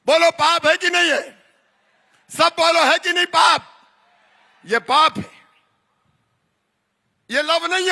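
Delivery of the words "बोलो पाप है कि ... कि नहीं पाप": booming political rally speech. Real, not acted. Rally call and response.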